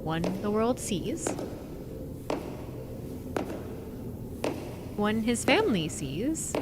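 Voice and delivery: slow, weighty ominous reading; dramatic reading. Acted. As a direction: lay each line down slowly like a trailer voice. Reads ominous quote dramatically.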